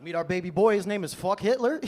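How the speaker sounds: proud announcement. Retold or imitated.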